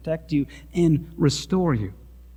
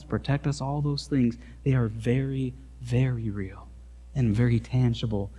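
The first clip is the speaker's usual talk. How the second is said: quiet sermon close; 3 semitones lower, 6 dB quieter than usual. Acted. Soft sermon application.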